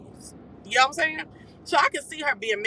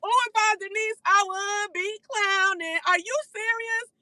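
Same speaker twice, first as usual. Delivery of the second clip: 1 high disbelief; 12 semitones higher than usual. Real, not acted. Outraged disbelief at ticket price.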